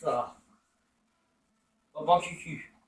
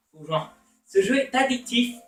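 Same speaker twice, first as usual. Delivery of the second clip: ordinary talk, nothing marked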